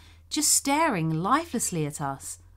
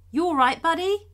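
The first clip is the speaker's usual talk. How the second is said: louder, higher call to a silent stranger; 10 semitones higher, 4 dB louder than usual. Retold or imitated. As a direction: hail the man, pitch rising toward the end. Calling out to a stranger.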